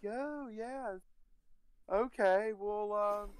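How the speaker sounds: high, breezy woman's voice; girl voice. Retold or imitated.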